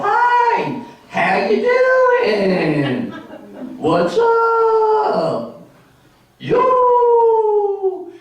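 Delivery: very high, stretched-out Southern drawl; hammed-up Southern hollers, each vowel held long; Southern greeting. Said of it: "they don't just say hello, they go".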